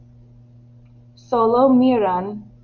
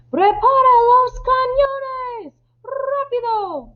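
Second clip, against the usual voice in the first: very high, drawn-out urgent yell; shouted order; 3 dB louder, slower than usual. Acted. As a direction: yell the command at a slow crewman. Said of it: "Antonio le grita". (orden gritada con urgencia)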